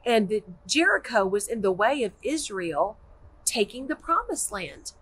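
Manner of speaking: usual speaking voice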